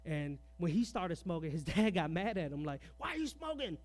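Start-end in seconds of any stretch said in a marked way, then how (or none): 3.0-3.8 high, squeaky mock scolding dad; shocked strict father. Grandfather catching his son smoking, high mock scold. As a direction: squeal it like a startled, disapproving father.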